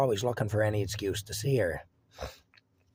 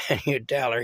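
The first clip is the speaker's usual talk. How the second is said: normal talking voice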